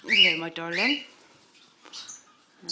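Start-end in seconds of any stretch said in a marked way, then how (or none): 0.0-1.0 loud pet greeting. Real, not acted. Greeting a bird in aviary.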